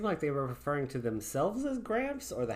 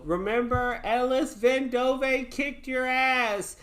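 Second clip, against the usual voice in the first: higher, slow taunt called after a fleeing bandit; shouted taunt; 9 semitones higher, slower than usual. Acted. Ellis shouts after the fleeing bandit, slow and high. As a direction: yell it after him, slow and proud. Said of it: "I shout out after him".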